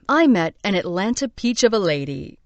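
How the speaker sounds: plain reading voice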